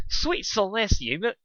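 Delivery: Rarity aghast at his mane; dramatic fashionista. Acted.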